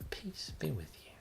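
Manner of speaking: whisper. Pretend. Mock blessing to festival goers.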